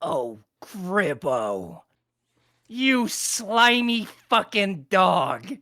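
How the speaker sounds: very slow, drawn-out villain insult; goblin boss standoff. Acted. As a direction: savour each word of the insult. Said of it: "and he's like".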